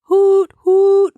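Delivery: high, loud hoot; two loud owlish toots; hooting whistle imitation. Shown as a demonstration. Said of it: "I do any type of sound effect".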